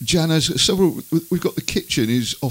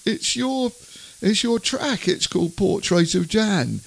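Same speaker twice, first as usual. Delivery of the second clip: innocent; 8 semitones higher than usual. Retold or imitated. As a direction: play the sweet innocent husband. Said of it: "I said, kind of all innocently".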